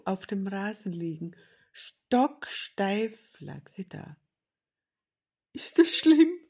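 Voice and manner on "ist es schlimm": high, loud worried question; anxious. A narrator's reading. Seppel covering his eyes before fainted Grandmother, anxious. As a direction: ask it dreading the answer.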